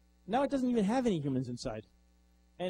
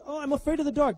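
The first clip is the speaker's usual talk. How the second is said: high, loud frightened little voice; scared robot; 9 semitones higher, 5 dB louder than usual. Retold or imitated. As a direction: plead like a scared toy. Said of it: "And the robot says".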